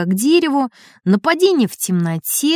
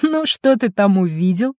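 snide question. A narrator's reading. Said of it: "ехидно спросил Гусев".

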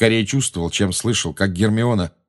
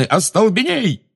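high, drawn-out spell cry; urgent, stretched exclamation; Гермиона's cry; 10 semitones higher than usual. A narrator's reading. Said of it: "он услышал крик Гермионы".

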